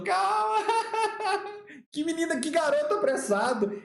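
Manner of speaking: agudo, caindo na gargalhada; risada zombeteira imitada. Retold or imitated. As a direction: imite colegas rindo do garoto apressado. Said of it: "deram uma risada na sessão". (gargalhada de deboche encenada)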